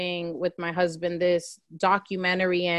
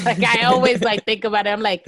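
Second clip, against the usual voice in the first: a laughing, louder run-up; 3 semitones higher, 6 dB louder, faster than usual. Real, not acted. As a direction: talk through a burst of laughter, louder. Laughs while praising guest's answer.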